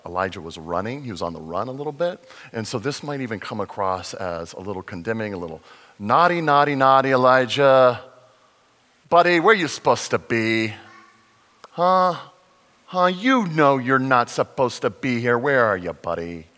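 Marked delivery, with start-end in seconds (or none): 6.0-16.5 loud, mock-scolding a naughty child; teasing parental scolding. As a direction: wag a finger and tell him off, louder. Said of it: "that's the tone I had when I first read it".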